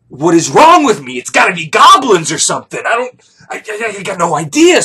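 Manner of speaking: loud, stammering mock despair; exasperated outburst. Man lamenting his failed erection, loudly exasperated. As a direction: burst out loudly, then flounder for words. Acted outburst after failing.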